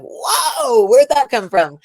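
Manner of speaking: high, loud startled whoa; imitating her own shock; startled surprise. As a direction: recoil at the sudden text. Reacting to his sudden message.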